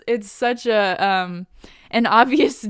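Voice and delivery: usual speaking voice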